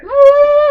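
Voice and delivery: very high loud yelp; startled squeal; mock yelp. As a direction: gamer yelp. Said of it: "It was like".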